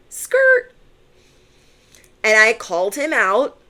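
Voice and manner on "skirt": much higher squealed skirt; cartoon skid squeal; mock tire screech. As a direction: screech like brakes. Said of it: "And I was like".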